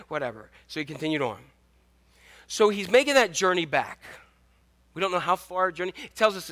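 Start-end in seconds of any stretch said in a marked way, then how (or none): none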